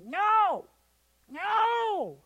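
put-on high, loud yell of an angry little girl; imitated child's furious high-pitched shout. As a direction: scream the refusal like a child in a tantrum. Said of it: "She's, like, yelling".

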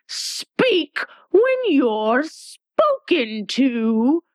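high, slow, sharp queen's rebuke; sharp Red Queen. A narrator's reading. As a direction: snap down at the child from on high, slowly. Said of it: "the Queen sharply interrupted her".

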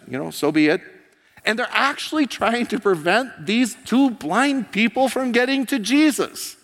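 loud, high, building sermon delivery; preaching at full force. Acted. Pastor stirring the congregation, preaching at full force. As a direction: preach it out to the back row.

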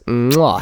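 loud smacking kiss into the mic; blowing an air kiss. Real, not acted. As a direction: blow them a big kiss. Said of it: "I'm gonna give you a kiss".

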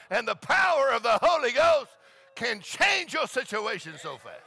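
high, loud, shouted preaching; preaching at full force. Acted. Preacher rousing the church about joy, shouting. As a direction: preach it out to the whole congregation.

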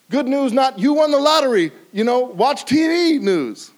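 high, loud, slow emphatic preaching; preaching at full force. Real, not acted. Preacher driving the point to the congregation, loud and high. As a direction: hammer each line home.

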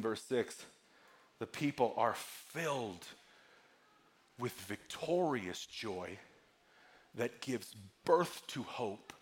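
low, soft, measured scripture delivery; hushed preaching. Real, not acted. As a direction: let each phrase settle quietly, with long pauses. Hushed, slow scripture teaching.

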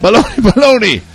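high, loud mock Italian chant; fake Italian. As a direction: sing out baloney like Italian.

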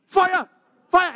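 high, loud panicked yell of "Fire!"; child yelling fire. Retold or imitated. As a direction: shout it like a little boy seeing flames. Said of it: "he'd be in there going".

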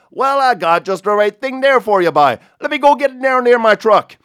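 loud, high Newfoundland call across the road; Newfie shout. Retold or imitated. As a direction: hail the stranger like a hearty Newfoundlander. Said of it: "avec ma meilleure imitation du merveilleusement charmant accent celtique".

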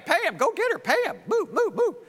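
high, loud, hurried commands; pushy shouted orders to servants; urgent orders. Acted. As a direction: bark the orders like a boss rushing his men. Servants ordered to fetch Sarah.

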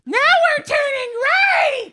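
put-on high, slow, shouted kid voice; loud excited child. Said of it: "would comment ... very loudly".

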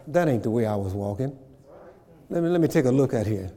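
ordinary delivery

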